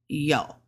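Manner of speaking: "yo" dropped low, Mandarin third tone. Shown as a demonstration. Teaching learners the tones, "yo" in low third tone. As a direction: let "yo" sink low. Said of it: "That's the third tone".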